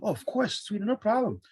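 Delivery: high-pitched, eager yes; upbeat high voice of easy agreement; breezy agreeable manager. Retold or imitated. Acted manager happily granting favor.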